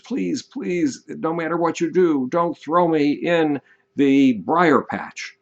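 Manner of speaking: high-pitched plea, drawn out at the end; begging and pleading. Retold or imitated. Brer Rabbit begging the fox who caught him, pleading. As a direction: plead in a high voice, imitating a desperate beggar. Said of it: "begged and pleaded and said".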